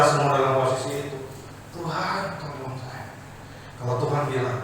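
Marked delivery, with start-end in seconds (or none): 1.7-3.1 soft plea. Acted. Soft prayer example.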